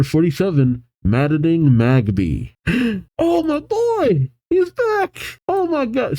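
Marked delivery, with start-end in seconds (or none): 2.6-3.1 sharp high gasp of delight; excited gasp. Real, not acted. Thrilled reader sees favourite return. 3.2-6.2 much higher squealing delight; real fan excitement. Real, not acted. Fan thrilled Luxray returns.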